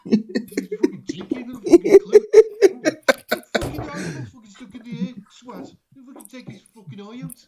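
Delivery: loud, high hammed-up rant; Stewie. Impersonating the friend slagging off another man, loud high mock rant. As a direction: rant as the mate, laughter breaking in. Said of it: "Your best impression is our good friend Stewie".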